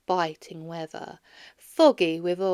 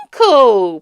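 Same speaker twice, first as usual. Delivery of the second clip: pleading; 3 semitones higher, 16 dB louder than usual. A narrator's reading. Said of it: "pleaded the nephew".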